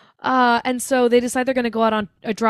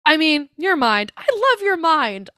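high-pitched, hammed-up correction; mock backpedalling; 6 semitones higher than usual. Annie taking back her confession to Paul, mock flustered. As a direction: hastily cover the slip in a higher voice.

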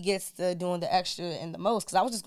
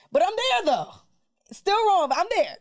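high, loud comic insistence; amused emphasis; 11 semitones higher, 5 dB louder than usual. Real, not acted. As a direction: play it up for laughs. Comic self-mocking emphasis.

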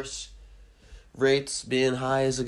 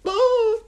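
high, loud silly moan; mock fat-person moan; 12 dB louder than usual. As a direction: cry out in a comic high pitch. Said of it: "And you're just".

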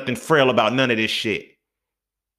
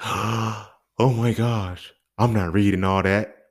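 mocking lazy reader; much slower than usual.

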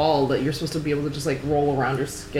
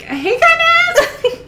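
put-on very high, loud, drawn-out Valley girl squeal; hammed-up Valley girl, breaking into laughter; 9 dB louder, much slower than usual. Reader mocking how Capitol people talk, exaggerated Valley girl. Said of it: "They're Valley girls".

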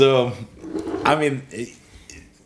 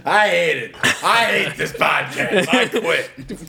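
mock anger; 6 semitones higher, slower than usual. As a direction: fake rage, quitting. Mock tantrum quitting podcast.

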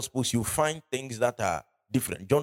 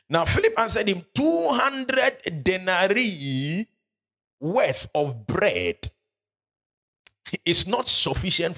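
higher, slow scripture reading; 7 semitones higher, slower than usual. Acted. Preacher reading Philip's answer to the church, high and slow. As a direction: raise the voice and drag the verse out. Reads verse seven.

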